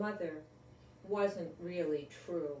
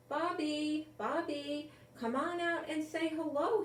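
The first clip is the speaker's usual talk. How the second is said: lightly voiced mother, higher, coaxing; mother calling; 8 semitones higher than usual. A narrator's reading. Mother calling her shy son out. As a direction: coax him out from under the bed. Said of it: "his mother would say".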